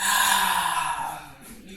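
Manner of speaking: long, loud sighing breath out; audible exhale sigh. Shown as a demonstration. Yoga teacher shows the class a loud exhale sigh. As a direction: let the breath go with a big sigh. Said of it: "Nice big exhale breath".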